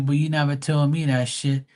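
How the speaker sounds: ordinary delivery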